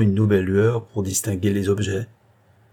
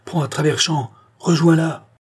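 high, urgent order; crying out; 6 semitones higher, 3 dB louder than usual. A narrator's reading. Maxime ordering his coachman to catch the carriage, high urgent cry. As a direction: shout the command, rising in force. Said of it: "s'écria Maxime".

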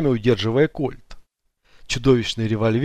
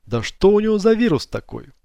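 usual speaking voice

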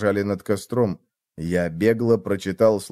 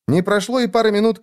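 ordinary delivery

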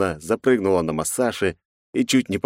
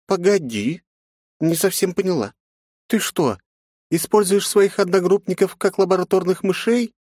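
surprised; 13 semitones higher than usual. Acted. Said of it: "удивилась бандитка".

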